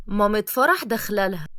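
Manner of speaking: ordinary delivery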